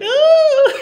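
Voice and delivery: piercing high mock shriek, loud; mock scream. Mocking the movie woman's scream, a high loud shriek.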